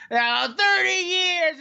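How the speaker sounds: high, slow mock sob; mock drunken whimper. Mocking a drunk crying about his marriage. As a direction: whine it like a self-pitying drunk.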